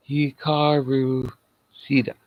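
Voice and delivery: Hikaru Shida slowly as hee-KAH-roo SHEE-da; careful pronunciation. Shown as a demonstration. Vlogger showing how to say the wrestler's name, slow. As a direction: spell out Hikaru Shida syllable by syllable. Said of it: "I believe is how you pronounce it".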